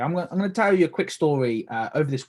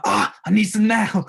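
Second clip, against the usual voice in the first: higher, louder eager "need some now"; craving exclamation; 7 semitones higher, 5 dB louder than usual. Retold or imitated. Craving more lemonade at the table, eager and high. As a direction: ham up wanting the drink.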